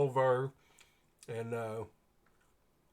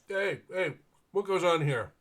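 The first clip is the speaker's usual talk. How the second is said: higher, louder guard's challenge; stern guard; 6 semitones higher, 7 dB louder than usual. Pretend. Guard challenging the running knight, loud stern call.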